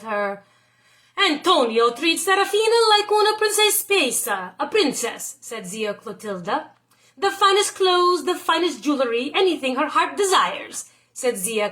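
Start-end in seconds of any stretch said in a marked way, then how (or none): 1.2-5.4 lightly voiced old aunt, a bit higher and louder; a hint of a nosy village aunt telling tales; gossipy aunt tattling, lightly done; gossiping aunt. Aunt gossiping about Tony. 7.2-10.9 lightly voiced aunt, higher and louder at first; a nosy aunt listing the spoils, lightly played; hint of a tattling old aunt; gossiping aunt.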